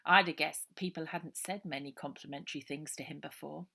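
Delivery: plain reading voice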